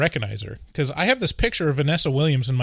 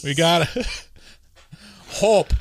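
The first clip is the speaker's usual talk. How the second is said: slow mock Chicago accent, laughing; clumsy Chicago accent breaking into laughter; Obama with Chicago accent; 4 semitones higher, slower than usual. Imagining the president talking local, Chicago accent. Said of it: "I'm not very good at Chicago accent".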